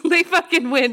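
laughing through the words. Retold or imitated. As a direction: laugh it out, giving up. Same quote repeated while laughing.

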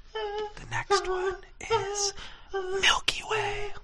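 slow, hushed whisper. Real, not acted. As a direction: whisper the answer to the audience. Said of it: "I'm gonna whisper it into the microphone".

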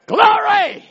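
high, loud joyful shout of praise; ecstatic cry of glory. Real, not acted. Preacher to congregation, a loud ecstatic shout. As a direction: burst out with Glory like a preacher on fire. Preacher exclaiming at peak of sermon.

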